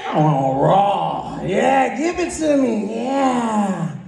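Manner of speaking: high, slow seductive coo; a hammed-up sultry come-on. Acting out a sexy partner.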